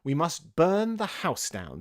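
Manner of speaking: rabbit's drawn-out command; drastic order. A narrator's reading. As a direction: declare it firmly to all. Rabbit declares drastic plan.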